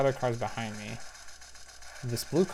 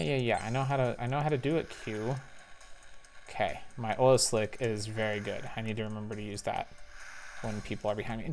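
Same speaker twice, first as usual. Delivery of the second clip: usual speaking voice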